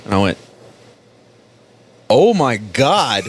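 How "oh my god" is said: slow mock dismay. Retold shock at Twenty-nine Palms.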